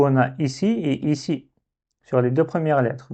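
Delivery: ordinary delivery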